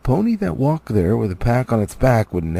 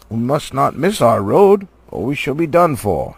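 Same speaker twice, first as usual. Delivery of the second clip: louder, earnest wizard warning; commanding old wizard; 3 semitones higher, 6 dB louder than usual. Acted. Gandalf warning the dwarves, loud and stern. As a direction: lead the party with stern authority.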